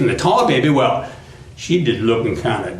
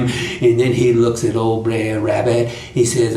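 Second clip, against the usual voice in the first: ordinary delivery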